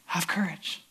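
low, soft comfort; calm them very quietly; gentle reassurance. Acted. Jesus announces himself to frightened disciples, gentle reassurance.

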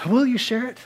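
ordinary talk, nothing marked

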